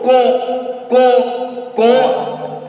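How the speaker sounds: "ko" with a hard k and nasal French o; syllable demo. Shown as a demonstration. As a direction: repeat "ko" as a separate, held syllable. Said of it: "Why ko?".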